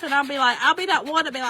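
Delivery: usual speaking voice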